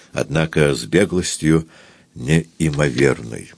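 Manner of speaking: ordinary delivery, nothing marked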